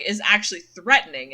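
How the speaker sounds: ordinary talk, nothing marked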